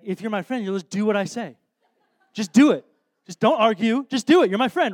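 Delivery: mock-demanding, building to loud, punchy orders; bossy friend. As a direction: order your pal around, hammed-up and insistent. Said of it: "none of us in this room are talking like this to our friends".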